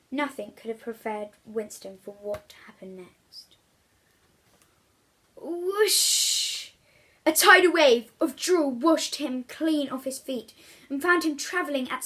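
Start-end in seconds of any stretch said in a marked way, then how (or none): 5.4-6.7 big rushing whoosh. A narrator's reading. Narrator to children, a loud rushing whoosh. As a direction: sweep the word out like a wave. Sound effect of the wave.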